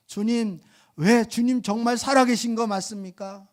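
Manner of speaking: high, loud whining plea to the Lord; complaining cry. Retold or imitated. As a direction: wail at God like a hurt believer. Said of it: "막 이렇게". (서운한 신자가 주님께 높고 크게 하소연하듯)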